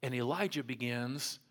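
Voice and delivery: ordinary talk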